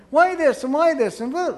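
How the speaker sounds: high, loud pestering questions; mock badgering wife; harsh nagging. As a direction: fire off whys with no gentleness. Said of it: "not a gentle and quiet spirit".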